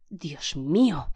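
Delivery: very slow, drawn-out cry of shock. A narrator's reading. Man seeing the shot judge, slow shocked cry. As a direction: cry out at the sight of the body. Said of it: "gritó Blor".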